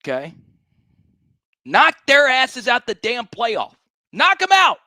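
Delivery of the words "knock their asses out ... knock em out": rising to a high, loud yell; fired-up shout. Real, not acted. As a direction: yell it like a pep rally. Hype rant before the game.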